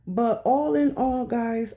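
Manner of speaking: ordinary delivery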